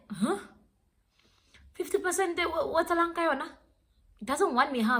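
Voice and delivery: high, incredulous questioning; baffled disbelief. Retold or imitated. Girlfriend retelling her reply to the fifty percent speech, incredulous. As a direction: act out a stunned, high-pitched reply.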